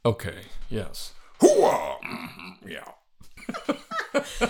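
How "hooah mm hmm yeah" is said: Hooah shout then Sling Blade grunt; Pacino Sling Blade mashup. Retold or imitated. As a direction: mash up the colonel and Karl. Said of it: "mix that with Sling Blade".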